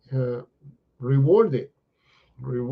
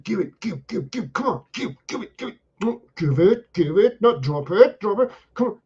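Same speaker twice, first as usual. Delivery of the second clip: higher, nagging "give it" over and over; pleading owner; 7 semitones higher, 3 dB louder than usual. Retold or imitated. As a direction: coax the dog impatiently.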